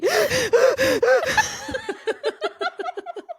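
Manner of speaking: high, braying forced laugh; donkey laugh. Shown as a demonstration. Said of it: "That's my donkey".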